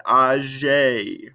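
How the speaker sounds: "Âgé" ending on a clear "ay" vowel; sounded final E. Shown as a demonstration. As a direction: voice the accented E of "Âgé". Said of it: "We pronounce that, uh, E at the end".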